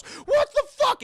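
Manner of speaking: very high, drawn-out loud yell; mock yell. Acting Ripley thrown across the room, mock yell. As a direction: yell it in high-pitched outrage.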